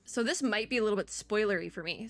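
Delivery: normal talking voice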